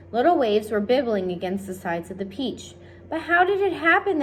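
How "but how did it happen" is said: high loud bewildered cry; desperate to understand; cried. A narrator's reading. The friends demand answers, high alarmed cry. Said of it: "they cried".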